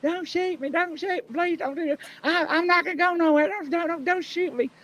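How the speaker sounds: very high, pleading; imitating the suspect's frantic plea; mock pleading. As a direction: beg in a squeaky mock panic. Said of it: "And he's like".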